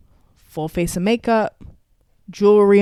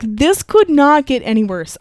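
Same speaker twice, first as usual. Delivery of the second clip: exasperated; 4 semitones higher, 6 dB louder than usual. Retold or imitated. Retelling her breaking point.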